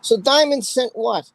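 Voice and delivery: normal talking voice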